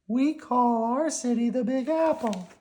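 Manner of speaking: high squeaky worm; high, cheerful little worm. Acted. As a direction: pitch it up like a tiny worm.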